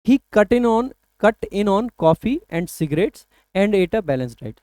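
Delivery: drawn-out dictation pace; careful slow read-aloud; slow sentence read. Shown as a demonstration. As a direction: read the sentence slowly and clearly. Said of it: "reads the exam sentence".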